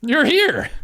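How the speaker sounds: high, loud delighted cry; excited discovery. Real, not acted. Host finally hears the missing guest, real excitement. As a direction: burst out high and loud on finding them.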